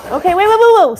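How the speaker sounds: loud, high, hurried stop-stop; flustered waits. Real, not acted. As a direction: pile on waits in a rush. Dog jumping near glass table.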